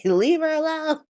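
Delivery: high-pitched "leave her alone" wail; mock impassioned plea. [name] defending [name] like the meme, mock impassioned. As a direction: plead for her in a hammed-up high voice. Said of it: "the embodiment of the [name]".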